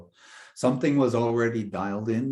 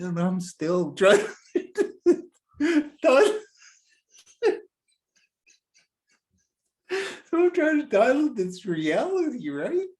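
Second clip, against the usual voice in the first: high, slow, talking through helpless laughter; can barely speak for laughing; cracking up mid-story; real laughter; 13 semitones higher, 4 dB louder, slower than usual. Real, not acted.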